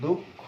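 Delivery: দুঃখ as "duk-kho", with a long k; clearly doubled k in দুঃখ; doubled consonant. Shown as a demonstration. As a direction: hold the stop inside দুঃখ before "kho". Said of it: "এই ধরনের উচ্চারণ হচ্ছে".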